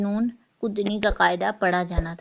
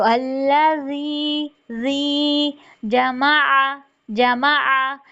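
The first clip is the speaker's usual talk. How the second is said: slow, higher tilawat; Quranic recitation; 5 semitones higher, much slower than usual. Shown as a demonstration. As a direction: chant it slowly. Recitation demo. (धीमी तिलावत)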